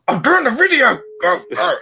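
mock-exasperated parent shooing a child; annoyed dad. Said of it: "and the guy's like".

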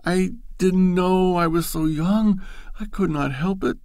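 high, tearful and loud; a young woman coming apart, near tears; distraught pleading. Acted. Loretta pleading with Bashford, distraught and tearful.

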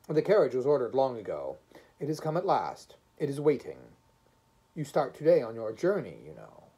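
loud, insistent official; loud, impatient driver; brisk, stern driver. A narrator's reading. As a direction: lay down the schedule with firm authority.

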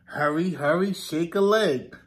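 ordinary talk

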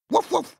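very high yappy woof woof; dog barks. Acted. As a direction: bark like a happy little pup. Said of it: "he barks".

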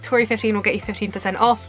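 usual speaking voice

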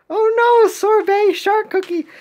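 high, loud cry of dismay; mock alarm. Crew spotting the shark left behind, high mock alarm. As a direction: squeal it in hammed-up panic.

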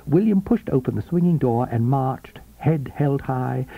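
usual speaking voice

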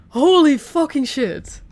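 loud, slow, relieved swearing; relieved exclamation. Real, not acted. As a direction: shaken outburst. Just escaped a jump scare.

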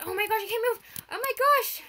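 high, loud panicked cry; panicked shriek. Real, not acted. Gamer panicking when she can't move, shrieking. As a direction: yelp as the controls lock up.

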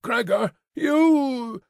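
high, drawn-out cry of accusation; angry cry. A narrator's reading. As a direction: cry out sharply, stretching the last word. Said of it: "cried out his sister with a raised fist".

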